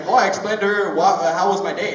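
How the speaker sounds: lightly voiced ex; imitating the ex. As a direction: play her breezy answer. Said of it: "She was like".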